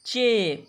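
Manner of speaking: "che" drawn out, falling; demonstrating "che". Shown as a demonstration. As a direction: sound out "che" for learners. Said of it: "तो इसका उच्चारण होगा".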